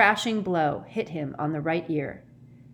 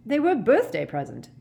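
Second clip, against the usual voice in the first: higher, indignant shout; indignant, raised voice; 6 semitones higher, 4 dB louder than usual. A narrator's reading. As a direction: shout back in self-defence. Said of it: "shouted Ron".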